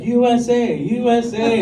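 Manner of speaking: imitated crowd chant, high and loud; crowd cheering. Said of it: "the crowd started cheering".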